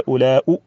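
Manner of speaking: "أولاء" said as ulaa-u, ending in short u; final damma. Shown as a demonstration. Teacher giving the third dialect, "أولاء" ending in damma. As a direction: end "أولاء" on hamza plus u. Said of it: "بضم الهمزة".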